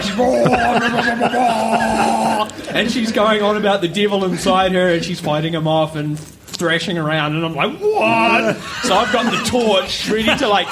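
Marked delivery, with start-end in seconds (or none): 0.2-2.5 high, very slow babbling gibberish; playing the girl speaking in tongues; weird tongues. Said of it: "talking in some sort of weird tongue thing". 7.8-8.6 higher, startled what; he cannot make sense of her thrashing; baffled what. Retold or imitated. Baffled reaction, retold.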